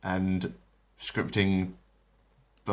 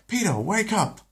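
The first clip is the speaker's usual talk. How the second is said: much higher urgent call; Lois calling Peter; 13 semitones higher than usual. Acted. Lois wakes her husband Peter, high urgent call. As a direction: jolt your husband awake.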